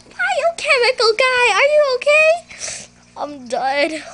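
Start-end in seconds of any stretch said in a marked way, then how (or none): 0.1-2.3 high worried shout. Acted. Calls to fallen friend.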